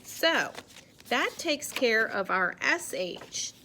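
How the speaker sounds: ordinary talk, nothing marked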